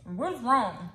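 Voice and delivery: talking through food; chewing between words; mouth full. Real, not acted. Seen 1: fork to mouth at -3; cheeks full, chewing motions through clip.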